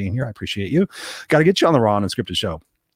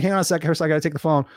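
higher-pitched mock phone chatter; hammed-up eager phone answers; distracted coaching client; 5 semitones higher than usual. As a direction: play a client interrupted by a phone call. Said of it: "he called me, like".

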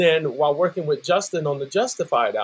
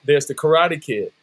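ordinary delivery

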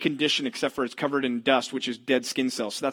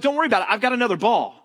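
put-on higher, breezy boy voice; high casual boy voice; confident kid; 6 semitones higher, 3 dB louder, faster than usual. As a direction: brush off the worry like a kid. Smalls voiced reassuring.

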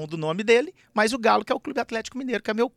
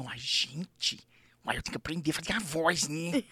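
imitating Zacarias; 5 semitones lower, 9 dB quieter than usual. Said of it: "só rodava os olhos e fazia a boca".

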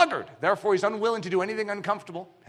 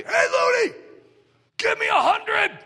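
much higher, louder barked order, hundred drawn out slow; drill sergeant shout; 13 semitones higher, 4 dB louder than usual. Retold or imitated. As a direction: bellow it like a boot camp drill instructor. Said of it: "just to have someone say".

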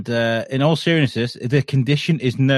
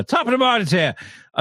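mock Irish accent; 9 semitones higher than usual. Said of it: "Graham also says".

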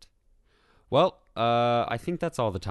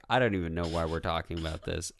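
ordinary delivery

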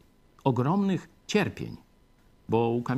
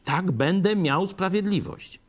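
higher and louder, a believer grabbing the promise; eager cry for justice; 8 semitones higher, 3 dB louder than usual. Retold or imitated. Preacher voicing a believer who craves justice, eager and raised. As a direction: voice the believer's eager relief, lifted and loud. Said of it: "człowiek by chciał sprawiedliwości".

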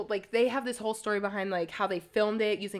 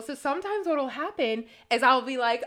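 ordinary talk, nothing marked

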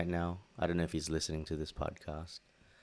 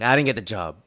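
loud mock announcement; 4 semitones higher, 12 dB louder than usual.

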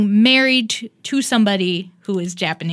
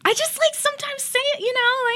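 squeaky and embarrassed; high sheepish voice; 3 dB quieter than usual. Real, not acted. Shy defensive admission.

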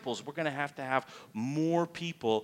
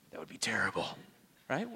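low, hushed acted dread; whispered dismay; 7 semitones lower, 7 dB quieter, faster than usual. Preacher playing a disciple who overlooked a command, whispered dismay. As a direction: whisper it as an awful thought.

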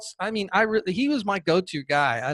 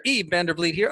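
ordinary delivery

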